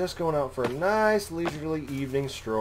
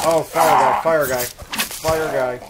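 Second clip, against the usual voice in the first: loud alarmed call, drawn out; urgent shout; 2 semitones higher, 9 dB louder, slower than usual. Real, not acted. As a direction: shout a warning, slowing down. Calling out the fire enemy.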